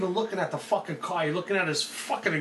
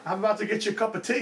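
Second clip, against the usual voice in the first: put-on fast, joking English accent; mock English accent on the edge of laughter; 3 semitones higher, faster than usual. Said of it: "but do an English accent".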